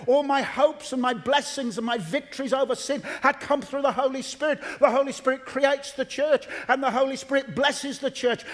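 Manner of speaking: high-pitched, rolling sermon delivery; full-force preaching. Real, not acted. Preacher testifying to the Holy Spirit's blessings, impassioned preaching. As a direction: preach it out in insistent, building phrases.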